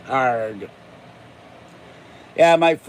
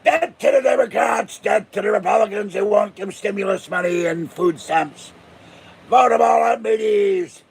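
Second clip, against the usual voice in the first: pirate rally cry; 5 semitones higher than usual. Pretend. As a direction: whip up the crowd like a pirate captain. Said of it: "And we all went".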